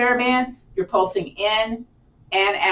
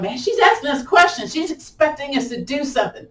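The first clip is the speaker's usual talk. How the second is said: lightly mocking class complaint, pitched higher; playful higher-voiced grumble; imitating reluctant students; 6 semitones higher than usual. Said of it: "Y'all going".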